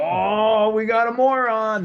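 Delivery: hammed-up town crier calling out a drunk; announcing shout. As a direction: bellow it out to wake the street. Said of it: "they're like".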